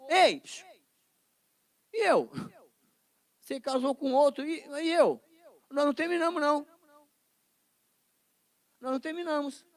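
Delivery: whiny ex. Acted. Ex-boyfriend returns and complains.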